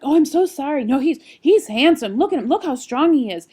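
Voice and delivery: loud, high, hurried backpedaling; flustered apology. Retold or imitated. Embarrassed stranger apologizing to mom, flustered and loud.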